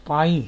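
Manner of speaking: پائل said loud as English pile; English word, loud. Shown as a demonstration. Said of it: "P I L E".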